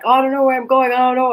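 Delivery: high, loud imitation of a lost driver; flustered mock wail. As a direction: mimic someone loudly flustered and clueless. Retelling friend lost while driving.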